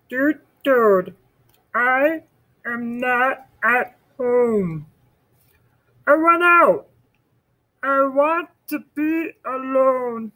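high, slow storybook Frog voice; Frog's note. Acted.

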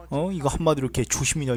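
ordinary talk

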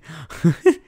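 brief breathy chuckle at the end; short laugh. Real, not acted. Laughing during match commentary.

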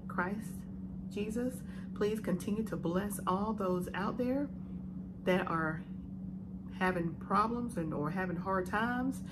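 soft, earnest mealtime prayer. Real, not acted. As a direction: pray quietly over the food. Prays over the meal.

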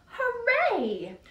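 high loud hooray; cheer. Acted. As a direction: cheer for the truck.

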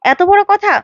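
high-pitched affronted outburst; indignant. A narrator's reading. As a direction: take offence, voice jumping up. Baidehi indignant at being ordered about. (ক্ষুব্ধ, চড়া গলায় প্রতিবাদ)

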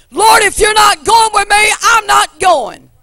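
loud, high pleading preacher's cry to the Lord; full-force preaching. Acted. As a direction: shout the prayer out to God.

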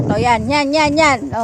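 normal talking voice

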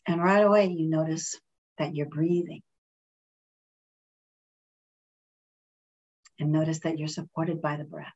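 calm, measured meditation voice with long pauses; guided meditation. Real, not acted. Reverend leading friends in a breathing meditation, calm and unhurried.

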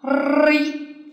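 ri with a long rolled r; doubled consonant. Shown as a demonstration. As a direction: roll and hold the r before the i in ri. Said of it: "叫叠音了".

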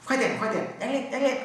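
high, pushy hurry-up call; urging boss. Retold or imitated. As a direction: chivvy the workers to speed up. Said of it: "chú Trạm bao giờ cũng yêu cầu".

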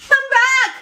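high, sudden call to return; sudden plea. Acted. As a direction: blurt it out high, calling them back. Acted boss abruptly changing their mind.

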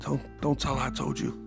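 usual speaking voice

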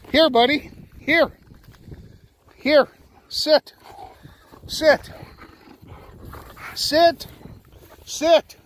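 high, loud calls to a dog; shouted commands. Real, not acted. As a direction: call the dog in, then order sit.

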